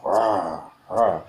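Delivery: two loud open-mouthed roars; imitated hippo roar. Shown as a demonstration. Hippo's big mouth sound.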